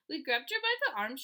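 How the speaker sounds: plain reading voice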